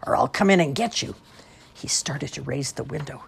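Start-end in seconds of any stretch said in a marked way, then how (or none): 0.1-1.2 louder, higher storybook threat; lightly snarled monster threat. A narrator's reading. Jerome as monster threatening the kids, lightly snarling. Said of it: "snarled the monster".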